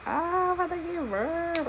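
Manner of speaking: breathless thrill on the way to delivery; light excited squeal. Retold or imitated. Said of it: "I was excited".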